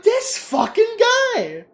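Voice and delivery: high, drawn-out incredulous rant breaking into laughter; mock outrage. Retelling a customer ruining the mopped floor, comically outraged.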